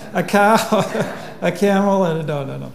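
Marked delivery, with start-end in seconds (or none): 0.6-1.4 short breathy laugh. Real, not acted. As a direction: laugh at the joke. Laughing at own joke.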